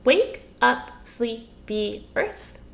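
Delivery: "Wake up, sleepy earth" slowly in separate beats; syllable by syllable. Shown as a demonstration. Teacher giving children the last line, "Wake up, sleepy earth" in slow separate syllables. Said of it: "How many syllables is that?".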